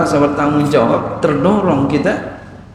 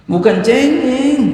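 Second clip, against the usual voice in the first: "Bukan cengeng" flung out much higher and louder, rising on "cengeng"; emphatic denial; 12 semitones higher, 4 dB louder than usual. Shown as a demonstration. Preacher correcting the congregation, high emphatic denial. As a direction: insist sharply: not whiny at all. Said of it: "Eh, bukan cengeng".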